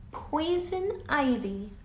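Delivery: usual speaking voice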